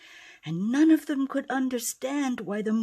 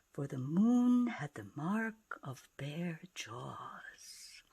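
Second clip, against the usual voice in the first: slow, breathy hushed telling; hushed narration; 4 semitones lower, 5 dB quieter than usual. A narrator's reading. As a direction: lower the voice for the reveal.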